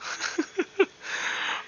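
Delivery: breathy, voiceless chuckle; teasing chuckle. Real, not acted. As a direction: chuckle quietly at the puzzled player. Game master teases a puzzled player.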